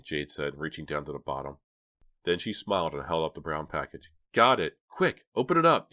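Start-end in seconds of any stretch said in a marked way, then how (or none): 4.3-5.9 high, loud and excited; excited cry. A narrator's reading. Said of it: "Dina cried excitedly".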